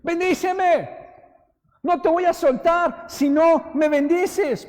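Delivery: high, loud, slow plea; crying out. Retold or imitated. Jacob clinging to God and crying out for a blessing, loud slow plea. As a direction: drag it out, begging with everything. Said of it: "está clamando".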